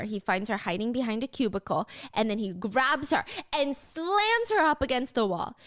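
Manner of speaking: usual speaking voice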